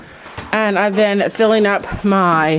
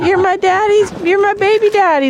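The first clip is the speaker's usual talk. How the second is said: voicing the doe; 10 semitones higher than usual. Doe voiced flirting with buck, high and gushing. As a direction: gush it up high like a smitten goat. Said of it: "she's like".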